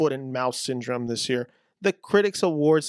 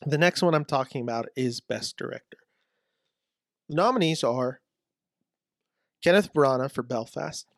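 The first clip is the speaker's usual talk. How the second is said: normal talking voice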